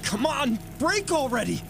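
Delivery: desperate straining shout. Acted. Bashing the glass.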